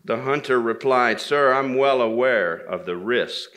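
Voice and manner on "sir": plain reading voice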